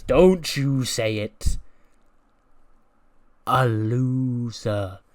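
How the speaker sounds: slow, loud, drawn-out threat; menacing Becky. Acted. Becky threatening Kelly before the slap, slow and loud. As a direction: spit each word out slowly like a threat. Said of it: "Becky goes".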